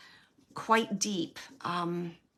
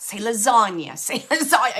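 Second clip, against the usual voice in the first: insistent urging; 9 dB louder than usual. Retold or imitated. Spirit urging her to say it.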